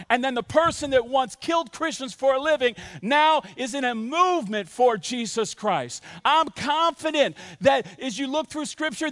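high-energy, emphatic sermon delivery; preaching. Acted. Pastor urging the church to believe, preaching. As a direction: drive each point home to the congregation.

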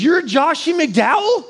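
put-on an old lady marvelling at the boy; amazed old lady.

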